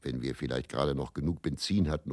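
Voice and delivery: ordinary talk, nothing marked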